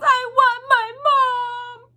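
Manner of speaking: slow, very high, loud sung cry; sobbing wail. Acted. Mig sobbing for her mother, high loud wail. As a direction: wail it out like a lost child. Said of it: "cried Mig".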